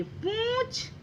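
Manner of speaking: पूंछ high and soft, vowel through the nose; nasal pronunciation. Shown as a demonstration. Teacher pointing out the nasal sound, पूंछ slow, high, nasal. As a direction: stretch the "oon" of पूंछ softly in the nose. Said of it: "नाक से आवाज निकली".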